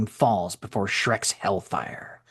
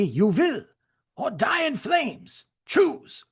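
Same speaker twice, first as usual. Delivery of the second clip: menacing German soldier; 6 semitones higher than usual. Acted. Shrek forcing the Frenchman to help him, rising menacing voice. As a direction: lean on him like a desperate soldier, slowing on the last threat.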